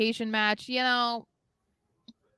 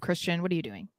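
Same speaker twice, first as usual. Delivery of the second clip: usual speaking voice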